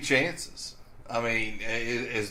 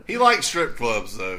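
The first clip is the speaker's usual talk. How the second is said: ordinary talk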